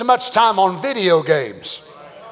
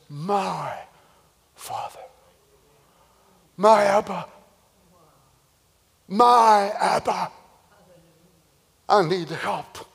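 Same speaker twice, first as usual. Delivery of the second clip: softer, whispered, slow plea for help; hushed plea; 4 semitones lower, 5 dB quieter than usual. Acted. Isaac quietly begging his father for help, whispered. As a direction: breathe it out like a frightened child asking for help.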